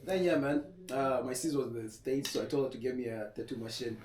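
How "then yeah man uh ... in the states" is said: normal talking voice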